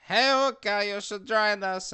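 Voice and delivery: high, loud call across the room. Acted. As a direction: shout to get his attention. Character calls to another player.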